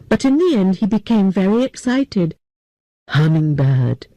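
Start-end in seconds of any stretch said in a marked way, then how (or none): 3.1-4.1 low, slow elder voice; a grave old man calling a name. Acted. The old president speaks.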